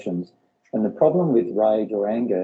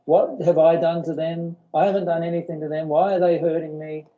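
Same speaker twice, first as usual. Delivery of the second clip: higher, plaintive, self-pitying questions; mock hurt complaint; 6 semitones higher than usual. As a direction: play the wounded victim asking why. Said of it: "You go".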